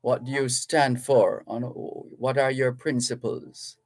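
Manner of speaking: normal talking voice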